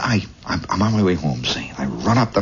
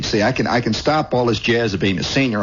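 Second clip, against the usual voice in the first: normal talking voice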